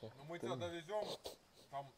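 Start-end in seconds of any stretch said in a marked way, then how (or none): none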